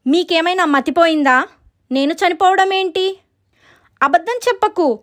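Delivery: high, protesting voice; acted offended visitor; indignant ghost girl.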